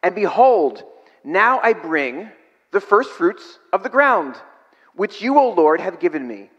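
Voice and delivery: slow solemn reading; scripture reading. Acted. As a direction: intone the offering words. Reads the offering prayer aloud.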